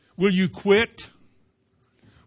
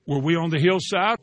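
normal talking voice

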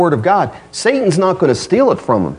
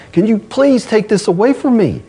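higher-pitched, lightly acted plea; retold pleading; 7 semitones higher than usual. Paul asking the Lord for relief, mildly acted pleading. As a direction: plead mildly, as if quoting someone's prayer. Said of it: "why would you be pleading".

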